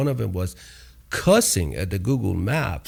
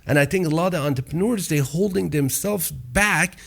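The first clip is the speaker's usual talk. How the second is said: fired up; 8 semitones higher than usual. Real, not acted. Passionate message to entrepreneurs.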